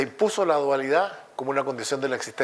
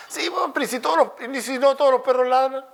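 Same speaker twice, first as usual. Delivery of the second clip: much higher, cartoonish objection; high mock voice; 13 semitones higher, 4 dB louder than usual. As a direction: squeak out the objection. Acted dialogue of philosophers.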